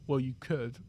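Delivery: low, quiet, reluctant concession; stiff, unfriendly. Retold or imitated. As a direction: imitate a frosty coach barely conceding. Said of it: "she really was quite stiff".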